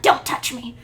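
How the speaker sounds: loud acted outburst; hammed-up angry warning; angry rejection. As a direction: push them off with a loud, hurt command. Ochako rejects Deku in grief.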